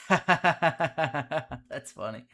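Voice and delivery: real burst of laughter into the words; laughing. Real, not acted. Amused at friend hearing the stream, laughing. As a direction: laugh it out, then comment.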